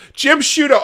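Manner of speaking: high, loud cry of the name; exasperated outburst. Real, not acted. Host berating a journalist over his article, exasperated. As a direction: call him out in disbelief.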